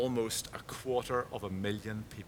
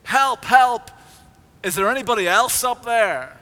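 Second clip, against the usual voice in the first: much louder, much higher yelling for help; desperate shout; 11 semitones higher, 11 dB louder than usual. A narrator's reading. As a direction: bellow it up the cliff in panic. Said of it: "until Jack shouted".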